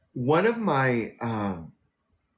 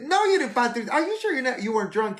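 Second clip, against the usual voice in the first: much higher, disbelieving questions; incredulous; 11 semitones higher, faster than usual. Retold or imitated. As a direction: push back in high-pitched disbelief. Doubting friend's wallet claim.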